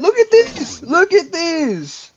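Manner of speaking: excited. Acted. Showing off the spoon.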